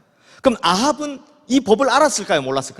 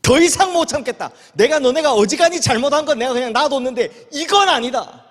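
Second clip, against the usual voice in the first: high, forceful outburst of divine wrath; exploding in anger; 6 semitones higher, 3 dB louder than usual. Acted. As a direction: erupt as God who has had enough. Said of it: "하나님이 폭발해버리신 겁니다".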